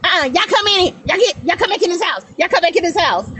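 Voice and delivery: high mock scolding call; imitating mom calling kids inside; yelling mom. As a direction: call the kids back in like an annoyed mother. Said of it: "we yell".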